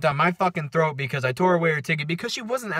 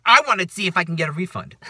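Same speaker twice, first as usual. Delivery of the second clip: louder, higher mock New Yorker; New Yorker accent; 5 semitones higher, 6 dB louder than usual. Woman asking slots for a refund, mock New York accent. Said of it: "like, New Yorker accent".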